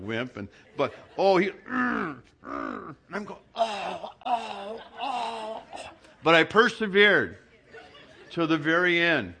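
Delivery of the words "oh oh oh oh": rising mock groans. Retold pained groans in massage.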